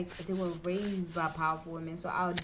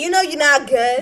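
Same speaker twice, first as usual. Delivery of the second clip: loud, high acted insistence; exasperated mock-argument yell; insistent confrontation; 6 semitones higher, 15 dB louder than usual. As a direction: call out a sulking partner at full volume. Acted argument with a sulking partner.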